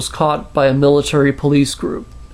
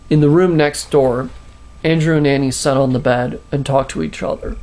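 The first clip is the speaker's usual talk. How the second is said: plain reading voice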